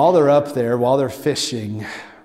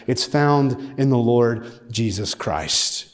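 ordinary talk, nothing marked

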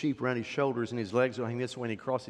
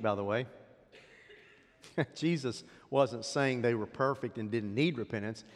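usual speaking voice

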